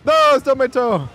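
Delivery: high loud protest; frustrated yell. Real, not acted. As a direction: cry out in frustration. Reacting to game mishap.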